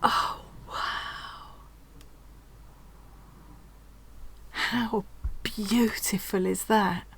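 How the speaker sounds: slow, breath-taken whisper; emotional whisper. Real, not acted. Crafter admiring a handmade gift, hushed and emotional. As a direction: whisper it softly, overcome and moved. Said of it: "I'm quite emotional".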